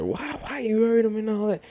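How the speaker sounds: high, shrill-pitched mock scolding; mother going off. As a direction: mimic an upset mother's high accusing voice. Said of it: "She, she going off now".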